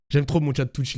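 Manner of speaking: ordinary delivery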